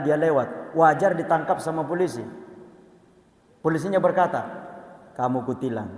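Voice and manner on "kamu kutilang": KAMU KUTILANG in a low, even monotone; flat intonation. Shown as a demonstration. Policeman to a student who ran a red light, flat intonation. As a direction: say "Kamu kutilang" soft and level, no stress. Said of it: "Intonasinya datar".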